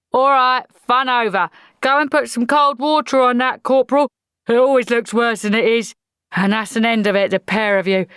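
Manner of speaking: loud barking drill sergeant; gruff sergeant. Acted. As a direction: bark it like an old army sergeant. Sergeant voice in audiobook.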